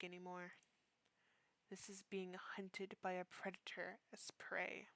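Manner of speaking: soft, near-whispered storytelling; hushed horror narration. Acted. Narrating the hiding scene to the listener, hushed and tense. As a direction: read it as if the monster could hear you.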